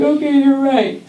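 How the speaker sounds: put-on little girl giving in; giving in kid. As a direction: concede sheepishly. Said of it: "I was like".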